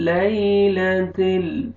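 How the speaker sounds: لیلۃ with a gentle ya, drawn slowly; soft slow recitation. Shown as a demonstration. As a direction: ease gently through لیلۃ ال. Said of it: "آپ نے نرمی سے ادا کرنا ہے".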